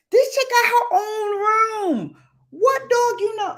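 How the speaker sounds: high, loud, incredulous; laugh-cry in disbelief; can you believe this dog; exasperated. Real, not acted. Exasperated about dog's room.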